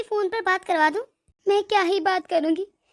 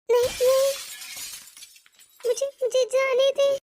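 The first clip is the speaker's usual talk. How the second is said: frightened pleading; 3 semitones higher than usual. Acted. Bride being locked in the room, begging to be let go. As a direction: beg them to let you go.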